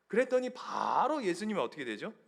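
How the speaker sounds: ordinary talk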